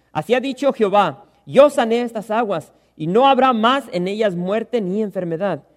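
loud, ringing pulpit reading of the verses; forceful scripture reading. Acted. Pastor reading Bible verses to his church, loud and ringing. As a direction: proclaim the passage to the congregation.

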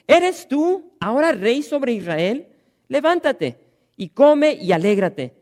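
slow, loud, scornful Jezebel; preacher voicing Jezebel taunting the sulking king, slow and loud; mocking queen. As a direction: sneer the question down at him, slowly. Jezabel mocks the king.

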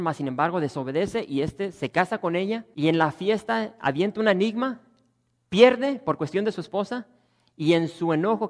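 loud, high, fervent sermon; full-force preaching. Acted. Pastor scolding stubborn Samson before the congregation, loud fervent preaching. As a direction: thunder it from the pulpit.

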